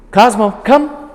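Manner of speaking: loud, high, crisp call of the dog's name and come; clear command. Real, not acted. Trainer calls her dog with a loud, commanding recall.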